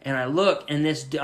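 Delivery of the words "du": ordinary talk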